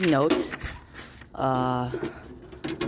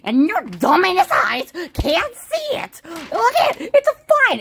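angry rant; 7 semitones higher than usual. Acted. Angry at Mister Finn.

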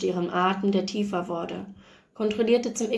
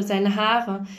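ordinary delivery, nothing marked

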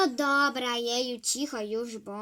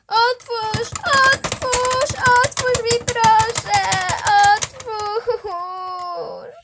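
high, loud pleading with a sob; teacher locked on the balcony begging to be let in, crying; desperate pleading; 8 semitones higher, 14 dB louder, much slower than usual. Retold or imitated.

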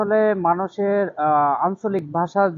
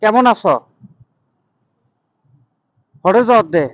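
Chittagong dialect, louder; 3 semitones higher, 7 dB louder, faster than usual. Retold or imitated. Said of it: "চট্টগ্রামের ভাষাটা যেমন".

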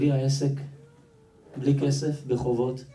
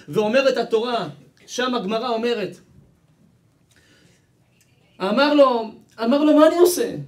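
loud, high preacher's cry; impassioned preaching; 9 semitones higher, 8 dB louder than usual. Acted. Preacher crying out a helpless man's plea to his congregation, impassioned preaching. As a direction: preach it at full force, voice pitched way up.

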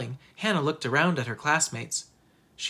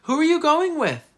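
very high, loud playground yell; narrated loudmouth boy calling out; shouting; 13 semitones higher, 7 dB louder than usual. A narrator's reading. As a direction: holler the question across a distance. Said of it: "Peter shouted across the playground".

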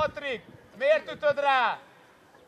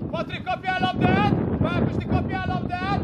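shouted instruction; 4 semitones higher, 4 dB louder than usual. Real, not acted. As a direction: call each player loudly by name. Coach shouts instructions to players. (hangos edzői kiabálás)

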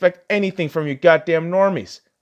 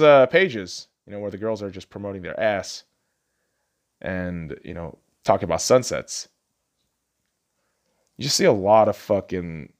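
ordinary talk

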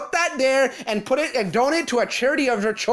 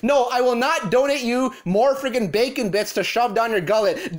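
ordinary talk

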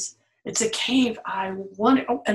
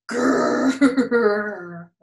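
growl; 4 dB louder than usual. Acted. Imitates night sounds growling.

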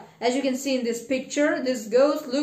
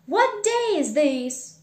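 louder, higher eager question; calling out; 4 semitones higher, 7 dB louder than usual. Acted. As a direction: call down to someone in the street. Scrooge calls from window to boy.

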